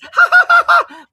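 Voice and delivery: high, loud hammed-up cackle; a shrill mock laugh aimed at a chat troll; mocking laugh imitation. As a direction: parody the opponent's chat laugh. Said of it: "He goes".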